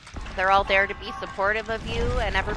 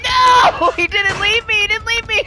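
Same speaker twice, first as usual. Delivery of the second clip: high, loud burst of relieved joy; overjoyed gamer cheer; excited yelling; 14 semitones higher, 10 dB louder than usual. Real, not acted. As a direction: shout it with laughing relief. Teammate came back for him.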